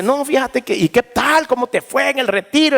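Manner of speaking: eager question. Retold or imitated. Family asks about the retreat.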